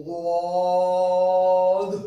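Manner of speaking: غاد drawn out very slowly and loudly with a throaty غ; غاد said with a throaty غ in place of ض; wrong Ghaad sound. Shown as a demonstration. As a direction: stretch غاد long, heavy and throaty. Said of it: "کی آواز نکالتے ہیں".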